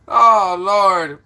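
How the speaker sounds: normal talking voice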